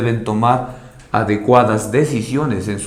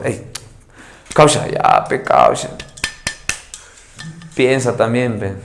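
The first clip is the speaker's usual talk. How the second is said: unvoiced plea to dad in slang; breathy, cheeky ask for pocket money; whispered "pe causa"; 3 semitones lower, 5 dB quieter than usual. Son asks father for money.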